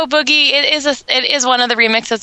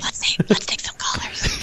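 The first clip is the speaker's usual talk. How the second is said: hushed conspiratorial whisper; 9 dB quieter than usual. Real, not acted. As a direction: whisper like sharing a secret. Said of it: "Why are we whispering?".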